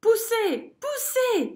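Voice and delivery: cris aigus essoufflés. A narrator's reading. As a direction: pousser à bout de souffle. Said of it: "s'essouffle Zigomar". (merle essoufflé qui presse)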